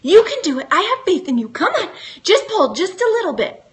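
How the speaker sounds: high, bright-eyed coaxing pet voice; cheering on the dog. Retold or imitated. Urging her tiny dog Molly to pull her leash free, high coaxing cheer. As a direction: pitch it up and urge the little dog on. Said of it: "I stand up and I say".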